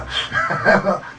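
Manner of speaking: short laugh at oneself; self-deprecating laugh. Real, not acted. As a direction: laugh off your own effort. Laughs at own singing effort. (照れ笑い)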